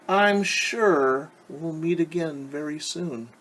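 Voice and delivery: a lightly voiced wolf, slow and smooth; smooth-talk the girl while hiding hunger; sly wolf. Wolf sweet-talking Little Red on the path, slyly.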